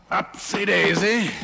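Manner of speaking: high, slow mock sing-song. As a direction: tease him like a toddler. Mockingly lifting beaten foe.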